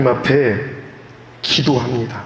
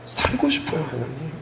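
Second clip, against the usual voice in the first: desperate plea; 8 dB quieter than usual. Acted. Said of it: "기도하는 히스기야".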